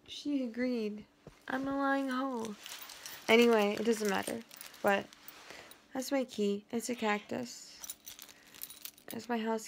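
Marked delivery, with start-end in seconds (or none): none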